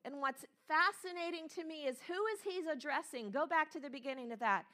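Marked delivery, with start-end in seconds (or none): none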